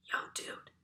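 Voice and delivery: hushed unvoiced whisper. A narrator's reading. Classmate whispering to the boy, a hushed whisper. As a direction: whisper it secretly across the desk. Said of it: "she whispered in my ear".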